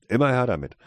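eager, playful demand; mischievous. A narrator's reading. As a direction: call it out impishly. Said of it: "ertönte die schalkhafte Stimme".

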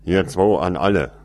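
loud radio call to all. A narrator's reading. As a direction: shout into the radio. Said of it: "hörte sie die männliche Stimme rufen".

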